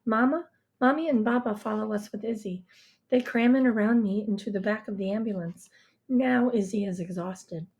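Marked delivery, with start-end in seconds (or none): none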